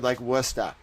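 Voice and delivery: thick Boston accent, dropping the Rs; a hammed-up Boston accent. Shown as a demonstration. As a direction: lay the Boston accent on, low and easy. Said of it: "Boston accents for every word".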